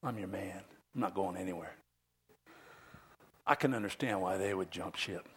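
hushed, confidential aside; low-key murmur, as if only one listener should hear; Peter. Acted. Peter privately assuring Jesus he will stay, hushed confidential tone. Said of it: "By the way, it wasn't a whisper".

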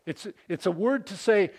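ordinary talk

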